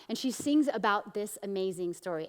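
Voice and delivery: normal talking voice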